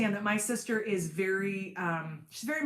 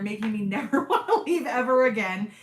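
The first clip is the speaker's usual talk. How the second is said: louder, laugh bubbling through the words; real laughing; 6 dB louder than usual. Real, not acted. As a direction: break into laughter while talking. Laughs while talking about her dogs.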